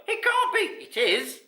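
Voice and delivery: high, loud disbelief then confirmation; amazed crowd. A narrator's reading. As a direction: trade astonished exclamations as onlookers.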